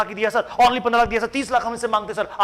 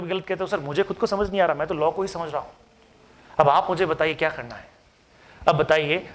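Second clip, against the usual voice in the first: ordinary talk, nothing marked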